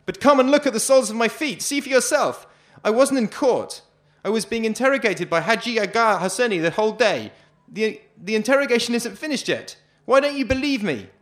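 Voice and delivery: a prisoner screaming his innocence to the guard; desperate scream. Acted. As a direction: scream and beg to be believed. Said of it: "I scream".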